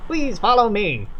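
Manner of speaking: slow, polite Kentucky drawl; Kentucky accent. Acted. Doolittle guiding the visitors, slow Kentucky drawl. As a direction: lead them on with a gentle Kentucky lilt. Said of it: "Oh, I'm Doolittle now".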